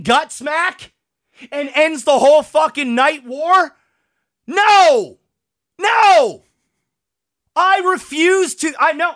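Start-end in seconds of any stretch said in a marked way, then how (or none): 4.5-9.1 outraged refusal. Real, not acted. Fan refusing to accept the war's ending, outraged yell.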